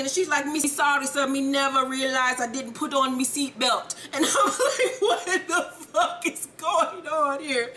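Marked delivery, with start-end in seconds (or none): 0.4-3.9 put-on Jamaican patois; Jamaican accent. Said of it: "this old Jamaican ass accent". 4.1-7.7 high, slow, laughing disbelief. Retold or imitated. Cracking up at the accent.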